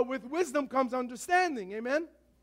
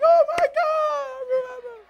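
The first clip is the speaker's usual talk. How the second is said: put-on high squealing girly shout; excited girl voice; 13 semitones higher, 7 dB louder than usual. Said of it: "the girls are like".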